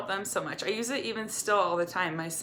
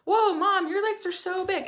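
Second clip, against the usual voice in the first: put-on high, innocent kid voice, much higher; imitating young kids; 12 semitones higher, 3 dB louder than usual. Mom imitating her young kids poking her stomach, high childlike voice. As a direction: blurt it out like a wide-eyed little child. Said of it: "they would poke my stomach and be like".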